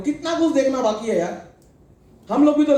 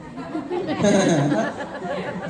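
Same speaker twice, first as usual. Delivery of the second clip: real laugh; 5 semitones lower, 3 dB quieter than usual. Real, not acted. Laughing at sulky face.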